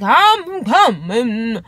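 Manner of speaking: drawn-out, slow, put-on voice; imitating a slow drawn-out answer; slow lazy reply. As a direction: stretch it out like you can't be bothered. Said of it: "he's like".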